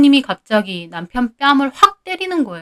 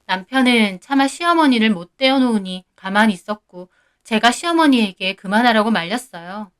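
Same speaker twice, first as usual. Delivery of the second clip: usual speaking voice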